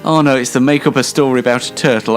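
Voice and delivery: ordinary talk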